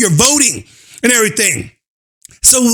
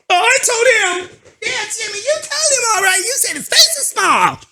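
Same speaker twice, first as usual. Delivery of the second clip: mocking kid voice; 9 semitones higher, 4 dB quieter than usual. Mock kid and friend.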